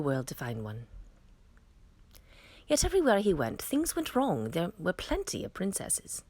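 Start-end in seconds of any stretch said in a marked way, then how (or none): none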